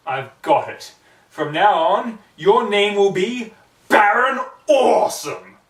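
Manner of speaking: loud, high, slow announcing the name; grand proclamation. Acted. Pony grandly naming her new falcon, loud slow proclamation. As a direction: proclaim it like a herald, drawing it out.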